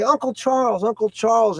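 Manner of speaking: put-on high, excited child voice calling out; little girls greeting. Little girls greeting their uncle, high and eager. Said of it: "they come up and say".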